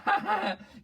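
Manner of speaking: high-pitched acted laugh; mock girlish cackle. Girl celebrating with her friend, mock gleeful laugh. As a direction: cackle like a delighted girlfriend. Acting the girlfriend's gleeful cheer.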